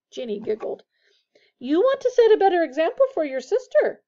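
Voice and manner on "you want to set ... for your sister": high, loud motherly rebuke; snapping. A narrator's reading. Missus Weasley scolds her sons, snapping. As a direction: snap a sharp telling-off. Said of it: "snapped Missus Weasley".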